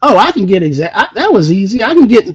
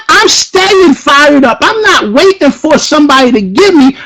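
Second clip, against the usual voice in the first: ordinary talk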